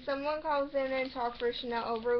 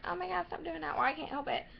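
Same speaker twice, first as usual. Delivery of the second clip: mock annoyed; 4 semitones higher, faster than usual. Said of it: "get annoyed and go like".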